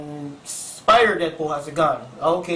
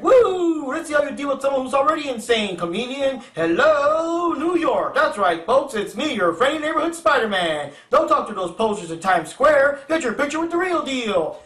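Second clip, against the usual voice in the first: Deadpool as Spider-Man; 7 semitones higher than usual. Acted. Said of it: "so he's like".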